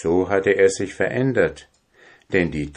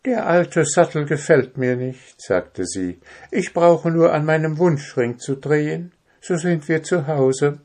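put-on higher, earnest young princess; 9 semitones higher than usual. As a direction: speak as a grateful princess. Princess answers.

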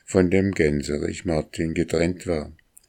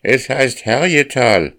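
high, loud rooster's cry; proud rooster voice, raised and ringing; crowing rooster; 7 semitones higher, 5 dB louder than usual. A narrator's reading. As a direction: crow the name out like a cock. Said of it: "krähte der Hahn".

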